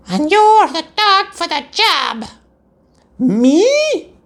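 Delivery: high, slow squeaky dog voice; high pup voice. Acted.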